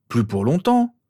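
protest. A narrator's reading. Son answers his mother about being a minor, protesting. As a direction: protest back with a rising pitch. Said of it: "protesta l'objet de son courroux".

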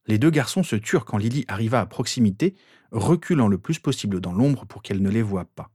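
ordinary delivery, nothing marked

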